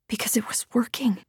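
breathless whisper of hope. A narrator's reading. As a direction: hush it, almost voiceless. Narrator drops to whisper.

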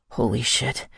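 low, lightly winded curse; panted. A narrator's reading. Kai catching his breath, lightly panted. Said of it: "he panted".

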